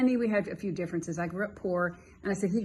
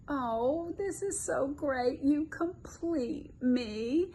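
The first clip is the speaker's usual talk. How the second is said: higher, slow mock swoon over a new date; 5 semitones higher, much slower than usual. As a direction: ham up the dreamy dating voice. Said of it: "you do oneness like this".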